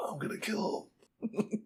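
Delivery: slow, breath-only threat; feeble whisper. Acted. Host voicing Zolo laid out, weak whispered threat. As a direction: strain it out with no voice left.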